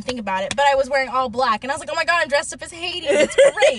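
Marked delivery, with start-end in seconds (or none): 1.9-3.8 laughing. Retold or imitated. Laughing at her own outfit.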